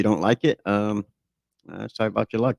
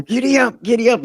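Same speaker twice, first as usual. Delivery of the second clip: put-on much higher, louder little-boy voice; five-year-old kid; 5 dB louder than usual. Man retelling how as a small boy he rode his dad, high kid voice. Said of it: "Or, like".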